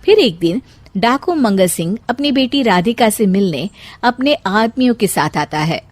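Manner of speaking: plain reading voice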